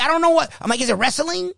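high, disbelieving questions; incredulous. Retold or imitated. Coach asks the other side, high and baffled, if it's wrestling. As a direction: sound baffled, as if it's absurd. Incredulous at rough youth game.

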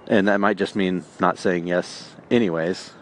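ordinary talk

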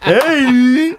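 high, drawn-out hammed-up hey; Christopher Walken. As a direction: stretch it high in a celebrity impression. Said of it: "like, a Christopher Walken".

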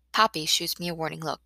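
plain reading voice

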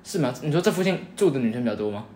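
usual speaking voice

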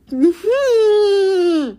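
long, loud, high squeal sliding down; high drawn-out fangirl whine; overcome squeal. Real, not acted. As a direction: squeal helplessly over a book. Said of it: "that was not a great noise".

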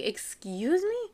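high, rising offended question; taken aback, imitating her own reaction; indignant disbelief. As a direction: act affronted, pitch leaping upward at the end. Mother acts offended reply to insult.

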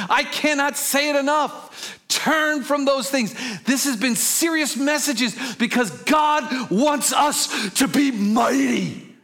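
high, slow, stretched exhortation; impassioned preaching. Real, not acted. Preacher urging the congregation to turn away, impassioned preaching. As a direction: drive each phrase home with urgent force. Preacher pleads at full force.

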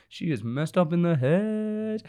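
slow, drawn out and rising high at the end; retold mentor's verdict. Mentor tells Barbie about her owner, drawn out. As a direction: stretch the last word into a high held note. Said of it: "then she's like".

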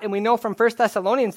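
ordinary talk, nothing marked

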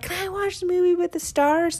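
put-on high little-kid voice, eager; little boy asking. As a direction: ask like a small child begging. Mother voices her little son.

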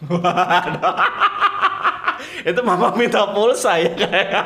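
cracking up while commenting; laughing. Real, not acted. Host reacts to co-host's mama-asks-for-credit joke, laughing. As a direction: talk through real laughter, pitch lifted.

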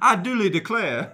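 high, loud Southern belle parody; hammed-up Southern accent. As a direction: declare it grandly in mock-genteel Southern. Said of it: "when you try to ditch it".

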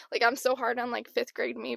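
usual speaking voice

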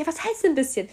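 high, insistent question; retold incredulous demand; exasperated. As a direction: demand an explanation, baffled. Asks yet again, exasperated.

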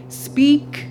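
commanding order. Retold or imitated. Maestro commanding his voice student to speak: firm command. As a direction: bark the order like a strict maestro. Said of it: "You commanded".

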